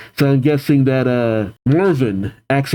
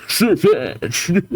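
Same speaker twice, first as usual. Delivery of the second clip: higher imitated Pokémon voice; Sirfetch'd dub; 5 semitones higher than usual. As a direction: mimic the dubbed creature saying its name. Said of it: "I love the dub of him".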